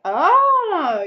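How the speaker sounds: high, loud, drawn-out mock "oh"; mock fascination. Everyone learning she is Swedish, mock fascination. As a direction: coo loudly with exaggerated interest. Mimics Americans meeting a Swede.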